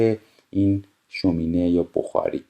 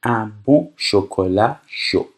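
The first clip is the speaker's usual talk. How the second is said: very slow, careful French reading; slow, clear dictation; much slower than usual. Shown as a demonstration. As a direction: read it out slowly for learners. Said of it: "teacher reads French slowly".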